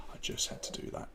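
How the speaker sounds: breathy whispered aside to himself. Real, not acted. Host fixing the stream, muttering to himself in a whisper.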